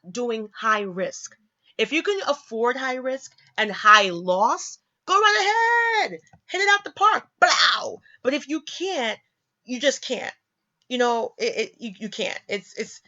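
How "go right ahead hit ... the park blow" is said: playful shouted send-off; mock cheering. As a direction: egg them on like a big hitter at bat. Mock cheering on risk takers.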